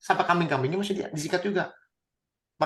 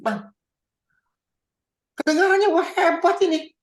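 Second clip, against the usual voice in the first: mock admiration; 13 semitones higher, 4 dB louder than usual. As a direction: gush sarcastically at the excuse. Mocking Saul's excuse. (kagum pura-pura, tinggi dan keras)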